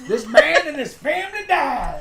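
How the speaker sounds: high, slow, laughing villain line; laughing mock threat. Mocking the villain line while cracking up. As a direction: giggle through the threat.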